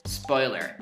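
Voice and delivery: ordinary talk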